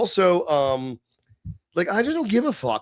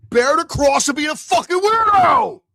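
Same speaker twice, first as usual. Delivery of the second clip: louder and higher, hammering it home; ranting shout; 9 semitones higher, 7 dB louder than usual. Real, not acted. To his stream viewers, a ranting shout. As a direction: bark it out like a sermon punchline. Ranting about being a weirdo.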